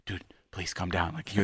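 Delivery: quietly calm an overexcited fan; hushed plea. Retold or imitated. Calming the fan outside the party, hushed.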